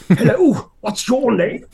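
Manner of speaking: high, booming, theatrical greeting; Brian Blessed impression. Old stage actor greeting a child, booming. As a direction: boom a grand welcome at a small child. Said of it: "he talks like that".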